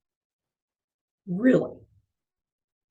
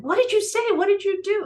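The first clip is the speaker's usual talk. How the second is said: high, louder astonished questions; amazed crowd; 11 semitones higher, 4 dB louder than usual. Retold or imitated. Bystanders asking the nuns in amazement, high and astonished. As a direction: gasp it out in wonder. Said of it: "people parted and they said".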